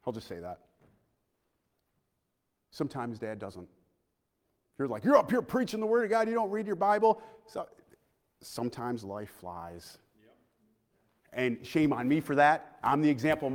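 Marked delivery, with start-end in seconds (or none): 5.0-7.2 higher-pitched mock accusation; lightly voiced scolding child; mock accusing kid. Daughter to preacher dad, mock accusing.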